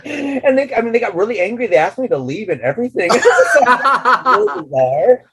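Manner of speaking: can't stop laughing while telling it. Real, not acted. Laughing about fans' angry reaction.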